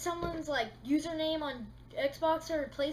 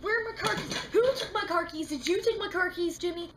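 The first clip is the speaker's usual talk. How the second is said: high, loud frantic questions; mock panic over lost keys; hammed-up alarm, much higher and louder; panicked search; 4 semitones higher, 6 dB louder than usual. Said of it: "five minutes later be like".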